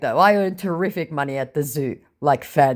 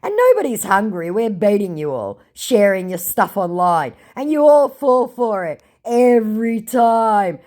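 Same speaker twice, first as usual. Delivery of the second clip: gloating taunt; 7 semitones higher, 5 dB louder than usual. Retold or imitated. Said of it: "I said".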